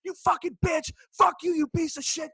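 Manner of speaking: high-pitched mock tirade, not shouted; imitated furious wife. Wife abusing her fleeing husband, imitated high-pitched rage.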